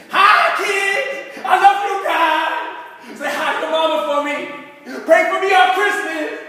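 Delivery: put-on loud, high, drawn-out drunken greeting; drunk uncle hollering. Drunk uncle shouting greetings to the kids across the street.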